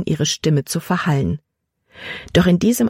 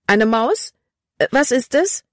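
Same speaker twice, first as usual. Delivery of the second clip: startled cry; 7 semitones higher than usual. A narrator's reading. Girl feels something flit past her, startled cry. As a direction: ask in rising alarm. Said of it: "Libby schrie auf".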